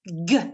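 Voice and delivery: "G" said as a hard "guh"; hard G sound. Shown as a demonstration. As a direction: say "G" as the sound guh, not the letter name. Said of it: "se prononce".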